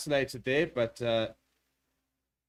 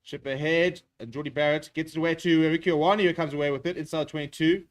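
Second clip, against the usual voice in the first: rising, louder play-by-play as the break opens; excited call; 4 semitones higher, 6 dB louder than usual. Real, not acted. Live try-scoring break.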